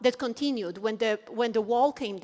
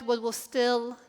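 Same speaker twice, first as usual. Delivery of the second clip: ordinary delivery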